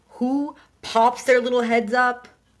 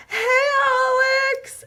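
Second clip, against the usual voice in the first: very high, drawn-out squealed hello; loud, stretched mock-excited call; acted greeting; 5 dB louder, much slower than usual. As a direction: sing out hello across a crowd. Greets neighbor spotted at the airport.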